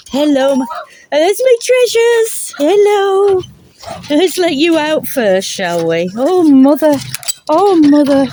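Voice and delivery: sing-song hello to the puppies; doting dog-owner voice at the door. Real, not acted. Cooing to excited puppies.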